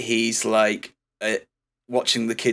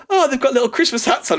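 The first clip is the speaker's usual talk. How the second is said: lightly voiced delight; 12 semitones higher than usual. Imitating Kurt Russell delighted.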